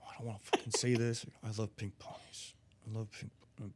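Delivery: acted reluctant mumble, fading away; pissed-off reluctance. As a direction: force it out quietly, hating it. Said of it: "almost pissed off doing it".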